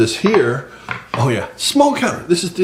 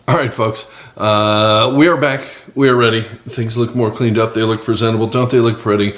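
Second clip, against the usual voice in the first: normal talking voice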